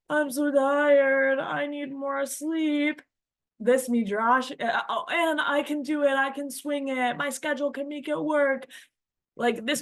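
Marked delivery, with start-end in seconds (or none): 0.1-3.0 mock whiny, higher and slow; sleepy inner voice. The inner voice begging for more sleep, mock whiny, higher and slow. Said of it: "a voice inside your head saying". 4.9-8.7 sleepy inner voice. Acted. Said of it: "a voice inside your head saying".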